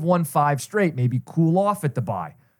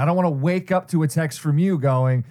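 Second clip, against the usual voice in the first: usual speaking voice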